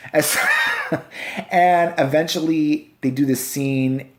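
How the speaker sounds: usual speaking voice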